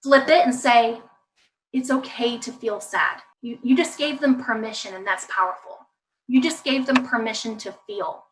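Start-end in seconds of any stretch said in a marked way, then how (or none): 1.7-3.2 soft, accepting tone; gentle reassurance. Shown as a demonstration. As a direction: give permission quietly. Models permission to child.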